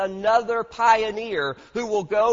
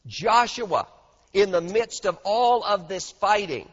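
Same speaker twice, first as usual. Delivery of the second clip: ordinary talk, nothing marked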